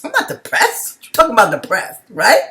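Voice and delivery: high, loud protest that she's not depressed; loud, high replay of her own indignant denial. Retold or imitated. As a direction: insist loudly, high-pitched, scoffing at the idea. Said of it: "This is exactly how I was".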